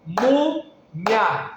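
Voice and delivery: "Múmia" in two separate beats, mú-mia; syllable by syllable. Shown as a demonstration. Teacher showing syllables as sound emissions, "Múmia" in two beats. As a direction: split "Múmia" into two sound bursts, ending glided together. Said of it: "uma emissão sonora".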